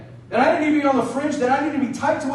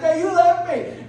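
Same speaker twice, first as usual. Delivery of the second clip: high, drawn-out mock wail of accusation; imitated tearful child's protest, slow and high; upset crying child; 6 semitones higher, slower than usual. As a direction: whine it like an abandoned kid. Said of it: "I'm frustrated, I'm mad at him, and I'm crying".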